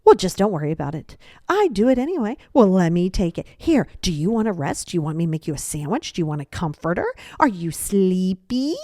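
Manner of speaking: mock-helpful, passive-aggressive fussing. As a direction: overdo the offers, drawing out the last one. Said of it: "the passive aggressive person goes".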